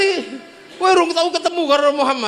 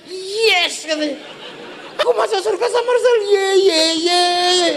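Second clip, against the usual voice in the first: high, fast, joyful whoops; overjoyed villager cheering at paradise news; delighted cheer; 7 semitones higher than usual. Acted.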